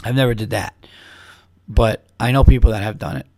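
ordinary delivery

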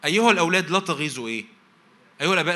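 high, loud proclamation; preacher calling out to the fathers; loud preaching call. Acted. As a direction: call it out over the church. Preaching at full force.

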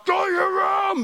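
high re-enacted angry yell; retold scream. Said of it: "I just screamed at the top of my lungs".

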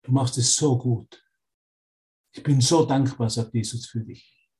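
gentle loving voice. Retold or imitated. Holy Spirit reassuring a student, gently and tenderly. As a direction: comfort them like a loving guide. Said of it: "die sanfte Stimme des Heiligen Geistes".

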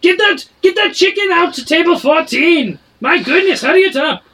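high, loud barking tavern keeper; a barkeep shouting from behind the bar; dwarf barkeep. Acted. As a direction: bark orders at the kitchen staff. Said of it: "He says".

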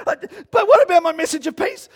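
very loud, high-pitched stammered outcry; acted-out panic. Preacher relives his meltdown over the peace sermon, panicked. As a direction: cry out in mock despair at full pitch. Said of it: "I'm having this moment".

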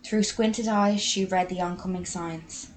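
usual speaking voice

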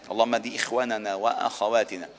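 ordinary talk, nothing marked

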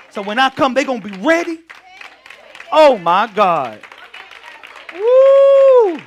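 loud, high triumphant cry building to a whoop; preaching shout. Real, not acted. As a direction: lift the church with a shout.

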